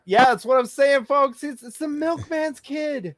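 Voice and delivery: high, loud excited exclaiming. Real, not acted. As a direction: hype the joke up. Excited joke about love child.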